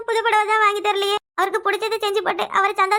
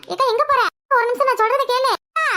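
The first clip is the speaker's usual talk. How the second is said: calling after her; 4 semitones higher than usual. Acted. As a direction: call her back before she leaves. Sister calls after leaving wife.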